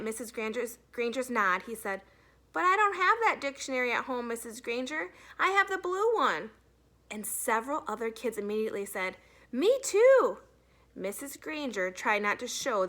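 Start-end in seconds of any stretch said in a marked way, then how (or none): 2.5-6.5 put-on lightly raised boy's voice, innocent; a hint of a kid playing dumb; mild schoolboy protest. A narrator's reading. Boy's reply in read-aloud. 9.5-10.4 put-on high, loud chorus of kids; loud eager kids; kids chiming in. A narrator's reading. Several kids chime in.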